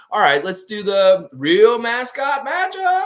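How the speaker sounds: big loud segment intro, last word stretched out high; drawn-out, high hype-man holler; announcer shout. Pretend. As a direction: belt out the title like a ring announcer. Announces his recurring segment.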